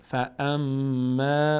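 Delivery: فاما with the doubled م hummed long; held nasal meem. Shown as a demonstration. Teacher demonstrating the doubled meem, long nasal hold. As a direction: slowly hold the م of فاما in the nose.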